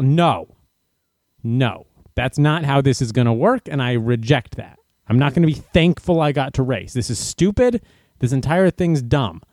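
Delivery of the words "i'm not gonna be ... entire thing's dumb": normal talking voice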